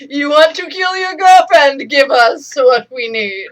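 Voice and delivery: loud, high, hammed-up Jedi command; jokingly will someone to obey; over-the-top hypnotic suggestion; mock mind trick. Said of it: "use the Jedi mind trick on him".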